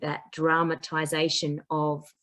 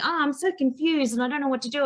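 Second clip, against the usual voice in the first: confused student; 8 semitones higher, faster than usual. Retold or imitated. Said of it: "people will say to me".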